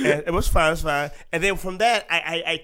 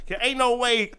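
ordinary talk

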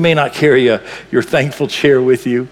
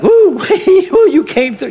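high laughing whoop, much higher and louder; joyful whoop; 14 semitones higher, 5 dB louder than usual. Retold or imitated. Rescued men cheering God after the fire: laughing whoops.

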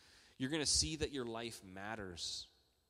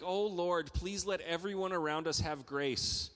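mock panicked prayer; 7 semitones higher, 9 dB louder than usual. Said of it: "and I was like".

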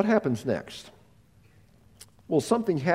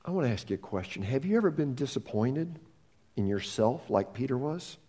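much softer, leaning in close; hushed question; 4 semitones lower, 7 dB quieter than usual. Real, not acted. Preacher confronting listeners' failures, softly and intimately. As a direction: ask it gently, one to one.

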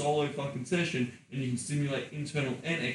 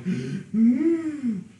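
a high sharp breathless gasp; 10 semitones higher, 6 dB louder than usual. Retold or imitated. Woman huffing and puffing after orgasm, gasping. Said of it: "she's huffing and puffing going".

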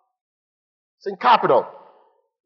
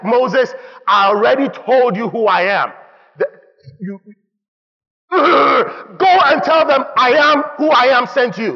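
impatient God; 6 semitones higher, 4 dB louder than usual. Retold or imitated. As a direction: spell it out, running out of patience. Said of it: "God was like".

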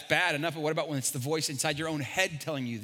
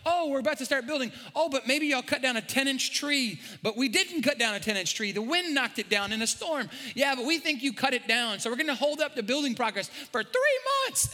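higher, whiny city official voice; mock city objector; 7 semitones higher than usual. Pastor imitates city blocking building, higher pitched.

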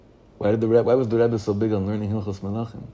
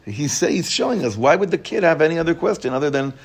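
ordinary talk, nothing marked

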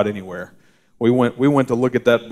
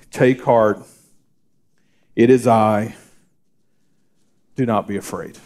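gentle reassurance. Acted. Jesus's words, soft reading.

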